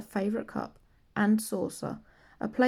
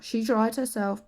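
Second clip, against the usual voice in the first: usual speaking voice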